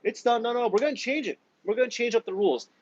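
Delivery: high, whiny mock panic; mock billionaire whine, high and fast at the end. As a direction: squeal it like a spoiled rich guy caught out. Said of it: "they're like".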